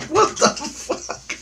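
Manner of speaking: high wheezing laughter through the words; laughing hard. Real, not acted. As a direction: crack up helplessly while speaking. Cracks up at his own example.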